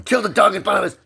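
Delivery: high, loud accusing cry; furious accusation. Real, not acted. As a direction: burst out in outraged accusation. Outburst against dad, then defends him.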